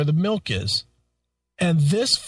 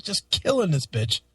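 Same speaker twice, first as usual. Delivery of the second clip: ordinary delivery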